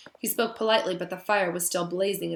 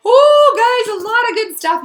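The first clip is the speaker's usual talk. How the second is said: much higher, louder thrilled ooh; excited ooh; 15 dB louder than usual. Real, not acted. Teacher thrilled about the chapter for her class. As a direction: burst out with delight.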